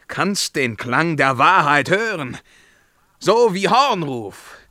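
ringing rise; swelling proclamation. Acted. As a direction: call it out like a horn.

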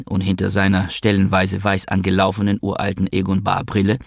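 plain reading voice

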